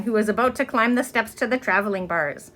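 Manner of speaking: plain reading voice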